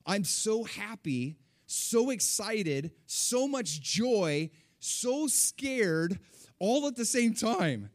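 loud, high, drawn-out emphatic outpouring; overwhelmed excitement. Retold or imitated. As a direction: stretch each phrase slowly, high and loud. Reliving overwhelming mixed emotions.

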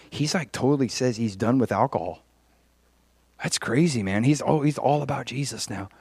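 quiet, confiding aside as if passing on news; hushed gossip. Acted. Passing on news about that guy, hushed gossip. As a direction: pass the word along softly.

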